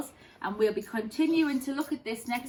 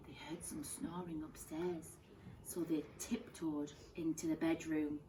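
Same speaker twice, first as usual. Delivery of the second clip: much softer, hushed telling; hushed narration; 5 semitones lower, 11 dB quieter than usual. A narrator's reading. Teacher telling bears creeping upstairs, hushed. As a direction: whisper it as they creep upstairs.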